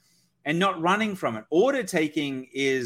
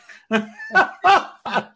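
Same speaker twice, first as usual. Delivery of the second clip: laugh; 8 semitones higher, 8 dB louder than usual. Real, not acted. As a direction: burst of loud laughter. Laughs at co-host's lost thought.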